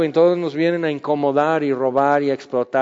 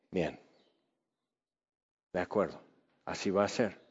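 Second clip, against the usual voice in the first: much softer, low resigned voice; grave quiet; 8 semitones lower, 14 dB quieter, faster than usual. Retold or imitated. God solemnly granting the people their choice, very soft and lower. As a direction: let it drop into a heavy, soft acceptance.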